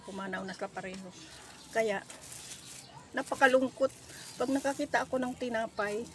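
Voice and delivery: ordinary talk, nothing marked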